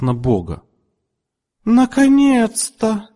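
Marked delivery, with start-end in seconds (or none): 1.6-3.1 very high, slow joyful shout; ecstatic cry. A narrator's reading. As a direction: burst out in delight. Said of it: "закричала она". (очень высокий восторженный возглас)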